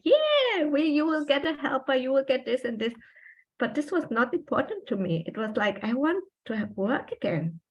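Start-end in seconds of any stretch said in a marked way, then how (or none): 0.0-2.9 much higher, gushing cheerful voice; imitating an overjoyed wife, pitched up high; mock excited wife. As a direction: play the thrilled wife who loves the news. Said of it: "he expected every wife was like".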